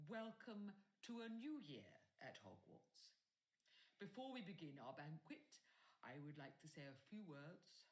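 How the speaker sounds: lightly voiced grand old headmaster; headmaster's speech. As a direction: address the whole hall with relish. Dumbledore speech.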